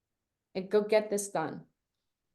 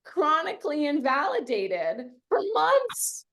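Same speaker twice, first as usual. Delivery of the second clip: high whiny mock complaint; mock wail; 9 semitones higher than usual. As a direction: wail it, hammed-up. Mock wail over lost validation.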